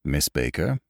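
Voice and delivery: low, soft butler's inquiry. A narrator's reading. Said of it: "he inquired".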